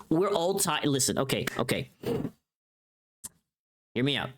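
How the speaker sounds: usual speaking voice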